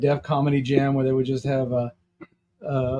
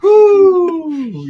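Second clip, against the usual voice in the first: loud, high, long "woo"; real high-pitched holler; excited whoop; 11 dB louder than usual. Real, not acted. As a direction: let out a big cheering whoop. Listener whoops after the jokes.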